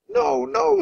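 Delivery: put-on very high small child voice; a scared little boy refusing.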